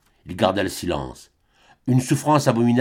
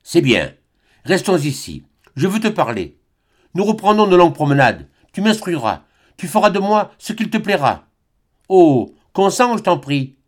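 Albine voiced higher, gently calmed; 8 semitones higher, 3 dB louder than usual. A narrator's reading. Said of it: "d'une voix calmée".

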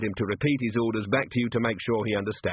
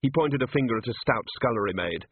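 ordinary delivery, nothing marked

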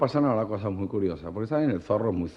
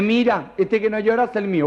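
much higher, louder proud camp voice; proud camp mother; 11 semitones higher, 5 dB louder than usual. Acted. Proud mother shows her baby through the nursery glass, high and camp.

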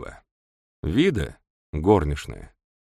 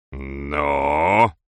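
deadly tone; 4 semitones lower, 4 dB louder than usual. A narrator's reading. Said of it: "убийственным тоном подбодрил доктор Фелл".

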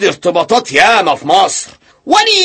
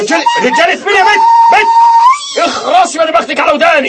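fast, higher angry shout; furious rapid scolding; jealous yelling; 9 semitones higher, faster than usual. Acted. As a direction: bark at her in jealous rage. Jealous husband yelling at wife.

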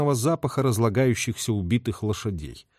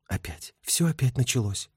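soft, weary inner voice; understated longing and dejection; with anguish; 4 dB quieter than usual. A narrator's reading. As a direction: think it to yourself, softly aching. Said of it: "подумала Шура с тоской".

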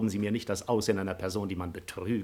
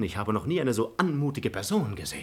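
ordinary talk, nothing marked

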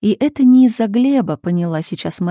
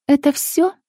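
higher-pitched cool question; cold; 7 semitones higher than usual. A narrator's reading. Mother questioning her daughter on the phone, coldly. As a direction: ask it coolly, pitched up. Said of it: "холодно спросила мать".

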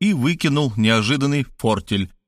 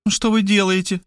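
higher, startled "Что вы делаете?"; surprised question; 9 semitones higher than usual. A narrator's reading. Markhaleva seeing Robert dial the phone, startled higher voice. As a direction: ask it with sudden alarm. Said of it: "удивилась Мархалёва".

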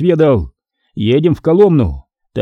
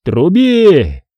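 raised, drawn-out call; shouted order; 5 semitones higher, 4 dB louder than usual. A narrator's reading. Semyon to Petrusha on seeing the envoy, shouted order. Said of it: "закричал Петруше Чурикову".

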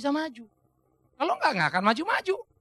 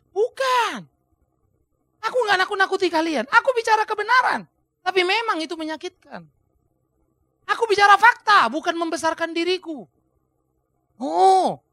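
higher, louder fervent defence; impassioned Paul; 7 semitones higher, 6 dB louder than usual. Acted. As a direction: defend yourself with fire. (Paulus membela diri dengan berapi-api)

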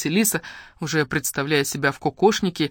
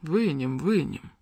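soft, eerie reassurance; hushed ghostly echo; otherworldly voices; 3 dB quieter, slower than usual. A narrator's reading. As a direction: assure like voices from beyond. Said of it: "заверили её какие-то потусторонние голоса".

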